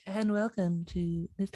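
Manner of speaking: soft, slow podcast welcome; hushed mock intro; low-volume intro. Mimicking own quiet show opening, soft and slow. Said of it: "All of a sudden my volume went from".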